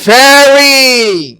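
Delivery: فیری said very slowly and clearly; drawn-out فیری for repeating; slow model word. Shown as a demonstration. Teacher pronouncing the word slowly for the class. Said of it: "اس کا تلفظ".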